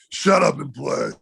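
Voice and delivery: imitated gruff, curt command; mock grouchy put-on voice; grouchy old player. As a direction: act the grumpy veteran dismissing a rookie. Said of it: "just a grouchy old dude".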